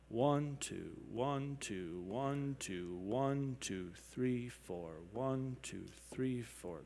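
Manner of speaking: soft steady count, beat one stressed; counting duple meter. Shown as a demonstration. Teacher counts two and four beats softly for the class. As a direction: count it out, leaning on each one. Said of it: "a duple meter would be like a two or four beat where we go".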